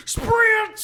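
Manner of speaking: very high, loud excited squeal; frantic squeal. Retold or imitated. Joking about sprinting onto the field, high squeal. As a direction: shriek it like you're bolting off.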